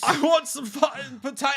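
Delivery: put-on high sing-song Scottish accent. Mocking Scottish accent, sung.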